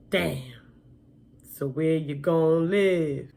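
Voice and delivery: slow, raspy man's voice; raspy older man. Retold or imitated. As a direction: drag it out in a rough, raspy voice. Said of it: "he has a really raspy voice".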